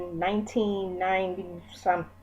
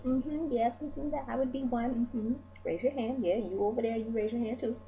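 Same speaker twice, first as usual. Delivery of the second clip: playful aside; 6 semitones higher, 6 dB quieter than usual. Acted. Playful aside admitting own issues.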